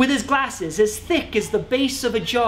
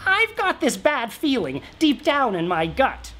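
lightly voiced king; 6 semitones higher than usual. King confides in shaman.

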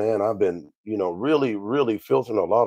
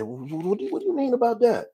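much higher, puzzled voice for the brother. Retold or imitated. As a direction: play the confused brother asking back. Brother's question acted out.

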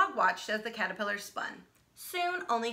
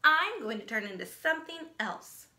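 braggy; 5 semitones higher, 4 dB louder than usual. Acted. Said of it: "She's such a braggy brown".

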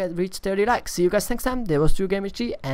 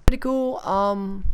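normal talking voice

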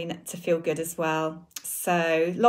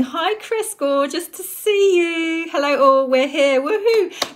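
much higher, loud cheery hellos; excited greetings; 8 semitones higher, 6 dB louder, slower than usual. Real, not acted. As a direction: greet everyone bubbling with glee. Cheerful greetings before starting.

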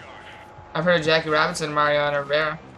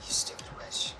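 whispered insult; 14 dB quieter than usual. Real, not acted. Insult muttered to friend.

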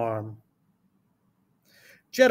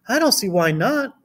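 lightly voiced teacher, pitched up; a hint of a higher teacher voice; Miss Carrington; 6 semitones higher than usual. A narrator's reading. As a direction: answer agreeably as a storybook teacher, higher. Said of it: "replied Miss Carrington".

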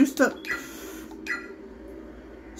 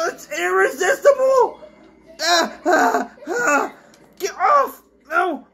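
panicked shout; 7 semitones higher than usual. Real, not acted. Panicked scream at game ghost.

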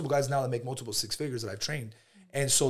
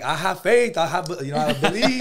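loud, high, hammed-up believer, laughing at the end; rally the crowd like a hyped-up preacher; mock inspirational preacher; 6 semitones higher, 10 dB louder than usual. Telling friends about his convention faith, a hammed-up loud preacher. Retold hopeful speech at conventions.

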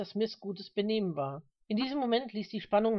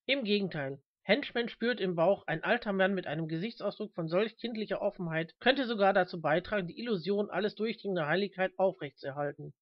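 usual speaking voice

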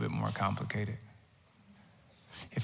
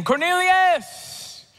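long drawn-out call, much higher and louder; angel calling; 6 dB louder, much slower than usual. Acted. Angel summoning Cornelius in a vision, drawn-out booming call. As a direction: call his name like a heavenly voice from afar.

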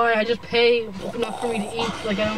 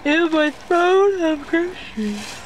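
mock regal voice; 6 semitones higher, 3 dB louder, much slower than usual. Mocking her seat on groceries.

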